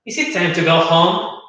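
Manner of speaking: "Is it time to go home?" lifting upward at "home"; rising question intonation. Shown as a demonstration. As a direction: let "home" rise as a clear question. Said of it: "esa entonación que tiene que ser como hacia arriba".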